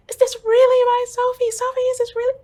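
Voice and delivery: very high, loud cry of disbelief; frantic disbelief. Retold or imitated. Mother on the phone to her daughter, shouted disbelief.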